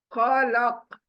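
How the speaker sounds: normal talking voice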